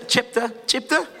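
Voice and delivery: "chapter" stretched out with a rising, questioning end; odd accent. Real, not acted. Preacher tripping over the chapter word, odd accent. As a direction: repeat "chapter" slowly in an odd accent, pitch lifting. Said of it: "what accent was that?".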